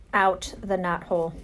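ordinary delivery, nothing marked

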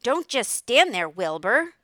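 loud, high goose crying out orders; bossy goose. A narrator's reading. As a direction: shout urgent commands like an excited goose. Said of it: "began to give orders".

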